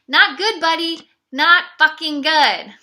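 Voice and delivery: loud, high, furious telling-off; a loud, slow, furious rebuke; angry scolding. Real, not acted. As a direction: slam each word down at him. Scolding the cheating ex.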